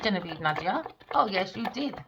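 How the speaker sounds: ordinary delivery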